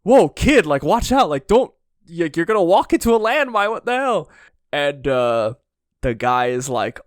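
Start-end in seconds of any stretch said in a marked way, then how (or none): 0.0-4.3 higher, louder hurried warning call; alarmed warning. Retold or imitated. Soldiers warn a war orphan about a landmine, calling out in alarm. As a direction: shout a quick warning to the kid. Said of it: "And they're like".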